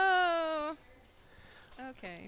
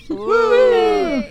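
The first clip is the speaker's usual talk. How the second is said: loud, high whoop; laughing cheer at full voice; excited cheer; 12 semitones higher, 13 dB louder than usual. Real, not acted. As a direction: let out a long celebratory woo. Cheering the announced scores.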